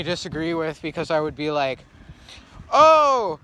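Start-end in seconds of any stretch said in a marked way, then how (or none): 2.7-3.4 surprised. Retold or imitated. Imitating own reaction.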